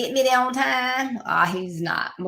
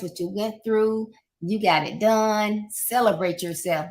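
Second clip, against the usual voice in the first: ordinary talk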